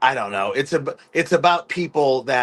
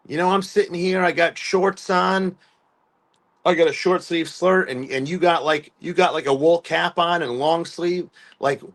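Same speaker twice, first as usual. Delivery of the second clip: higher-pitched, ribbing mock voice; teasing firefighter; 6 semitones higher than usual. Firefighter teasing [name] about his wool cap, higher mock ribbing. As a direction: rib him like a cocky firefighter. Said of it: "just teasing me".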